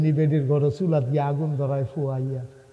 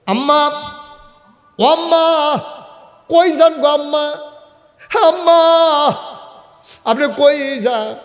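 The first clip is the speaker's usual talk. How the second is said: very high, loud repeated calls; calling after someone; 11 semitones higher, 8 dB louder than usual. Acted. Omar calls to the fleeing mother, loud high shouts. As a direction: shout after her, pitched right up.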